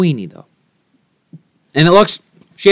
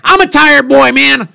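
high, louder protest of tiredness; exasperated complaint; 9 semitones higher, 5 dB louder than usual. Real, not acted. Tired host protests to his distracted co-host, exasperated. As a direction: plead it at a raised pitch, fed up.

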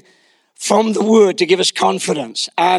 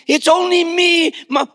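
put-on high, timid child voice; hammed-up cowering little boy; mock terrified son; 8 semitones higher than usual. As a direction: squeak it out humbly, afraid of the answer. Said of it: "shaking in his shoes".

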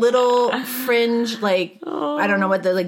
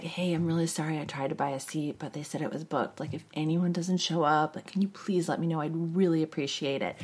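quiet, sweet-talking request; soft pleading; 3 semitones lower, 10 dB quieter than usual. Retold or imitated. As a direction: plead softly and politely. Pleading with the gate agent.

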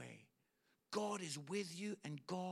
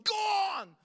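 high, very loud cheer; crowd shout; 13 semitones higher, 13 dB louder than usual. Retold or imitated. Crowd cheering the tired lad, loud high shout. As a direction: yell it like the whole crowd. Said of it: "they're shouting and they're going".

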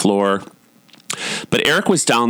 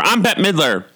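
high-pitched diva declaration; 8 semitones higher than usual. Retold or imitated. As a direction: proclaim it like a defiant star, lightly mimicked. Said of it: "she started with an accent, but then said".

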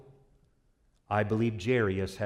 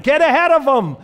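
high, loud excited yell; yelling to girlfriend; 13 semitones higher, 9 dB louder than usual. Acted. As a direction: shout it across the crowd, much higher. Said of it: "he yells out to his girlfriend".

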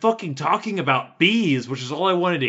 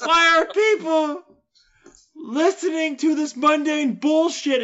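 high, loud indignant rant; angry yelling; 14 semitones higher, 3 dB louder than usual. Real, not acted. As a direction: keep ranting at the listeners. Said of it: "I know I'm yelling and I'm angry".

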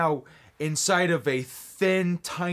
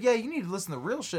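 fast know-it-all; 6 semitones higher, much faster than usual. Retold or imitated. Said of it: "And he's like".

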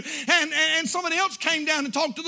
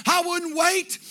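altar call preaching; 4 semitones higher than usual. Acted. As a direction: urge them down the aisle. Altar call at full force.

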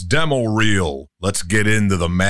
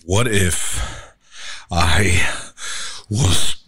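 werewolf transformation; 3 semitones lower, slower than usual. Shown as a demonstration. Demo, asking while turning into a werewolf, growling. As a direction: voice breaking into growls. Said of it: "transforming into a werewolf".

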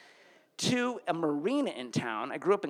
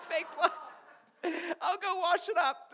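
higher, panicky laughing excuse; frantic high cover story; 9 semitones higher than usual. Retold or imitated. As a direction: babble the lie in panic. Panicked lie to mom.

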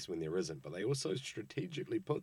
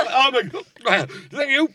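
much higher, louder, slow and laughing; mock fangirl gush; 14 semitones higher, 17 dB louder, slower than usual. Friend mocks the kid thanking her idol, laughing. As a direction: gush through laughter like an overwhelmed fan. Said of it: "You're like".

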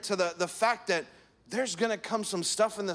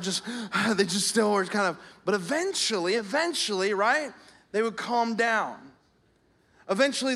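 normal talking voice

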